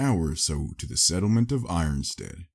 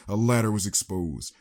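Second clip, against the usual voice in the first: plain reading voice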